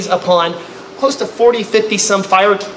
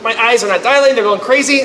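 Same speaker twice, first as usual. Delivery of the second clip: ordinary delivery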